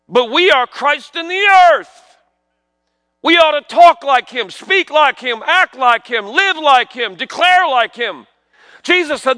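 high, emphatic pulpit preaching; fervent preaching. Acted. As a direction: build to a ringing proclamation with punched phrases. Preaches at full force.

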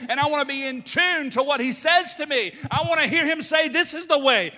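loud, high, driving pulpit cry; full-force preaching. Real, not acted. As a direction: preach it out with rising fervor.

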